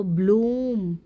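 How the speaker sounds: ब्लूम with a drawn-out oo; ब्लूम, oo stretched long. Shown as a demonstration. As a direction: hold the vowel in ब्लूम before closing on m. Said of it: "long है".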